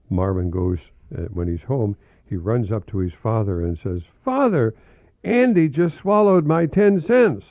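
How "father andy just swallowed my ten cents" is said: put-on high, loud, urgent child's cry; alarmed child. Marvin runs up to his father, high and alarmed. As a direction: blurt it out as a panicked little boy.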